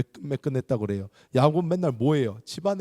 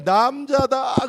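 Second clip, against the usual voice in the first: very loud, high, slow shout; cheering shout; 12 semitones higher, 5 dB louder, much slower than usual. Retold or imitated. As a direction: bellow it like a cheering crowd. Boys shouting.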